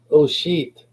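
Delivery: high, sudden exclamation; imitating Nana. Grandmother just before she dropped dead, high imitated exclamation. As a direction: pitch it up as Nana caught short. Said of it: "And she was like".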